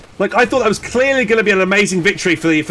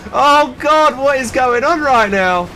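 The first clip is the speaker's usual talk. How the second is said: shocked disbelief; 5 semitones higher, slower than usual. Real, not acted. Caster reacting to a Panzer getting wiped, stunned. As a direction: gasp it out as the tank is lost.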